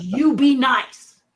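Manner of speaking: slow, loud, stern 'you be nice'; boss's stern warning. Retold or imitated. Brian warning Terry before the take, stern and slow. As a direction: warn the puppeteer sternly and slowly. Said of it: "And he goes".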